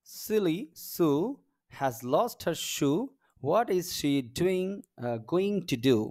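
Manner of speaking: very slow, word-by-word teacher reading; model reading aloud. Shown as a demonstration. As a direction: stretch each word for learners to follow. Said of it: "এটা একটু পড়ে দেখি".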